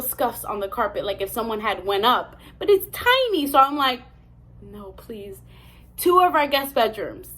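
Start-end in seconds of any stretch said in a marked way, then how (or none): 4.6-5.4 very soft retold pleading; hushed plea. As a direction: beg under your breath. Retold dread climbing the stairs.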